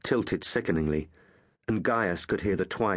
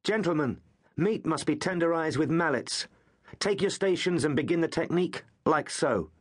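higher, mocking drill instructor; hard voice; 9 semitones higher than usual. Suetonius mocks his friends before the beating, hard mock lecture. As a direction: address them like a cruel teacher. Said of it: "The hard voice of Suetonius".

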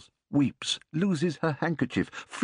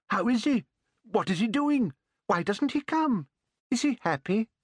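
higher, tumbling eager questions; 9 semitones higher than usual. A narrator's reading. Old couple eagerly questioning the visitor about their grandson. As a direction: pepper him with questions.

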